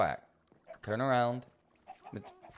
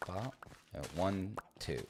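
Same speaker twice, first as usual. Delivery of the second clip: normal talking voice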